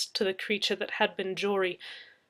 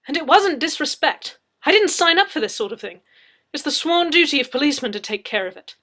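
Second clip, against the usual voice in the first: higher, louder indignant protest; 6 semitones higher, 12 dB louder than usual. Acted. Leslie protests to Anna, indignant. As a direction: insist it's not your job.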